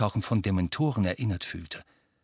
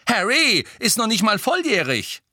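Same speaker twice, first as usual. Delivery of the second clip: mimicking mother; 13 semitones higher, 5 dB louder than usual. Twin continuing the mother mockery.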